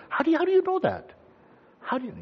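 put-on high-pitched child's question; baffled child. Children asking an all-knowing parent, baffled. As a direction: imitate an amazed kid asking.

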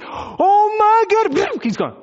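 long, loud, high cry of shock; acted freak-out. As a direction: stretch it into an astonished wail. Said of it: "they start freaking out".

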